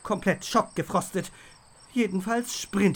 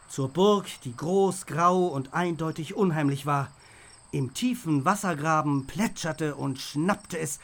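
plain reading voice

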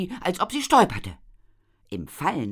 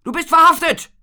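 much higher, much louder triumphant bellow; roared; 14 dB louder than usual. A narrator's reading. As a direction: bellow it like a policeman who has you. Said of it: "brüllte Eltinger".

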